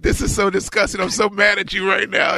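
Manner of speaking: high, whiny fake outrage; mock anger. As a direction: put on a squeaky voice pretending to be mad. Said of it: "Montoya's like".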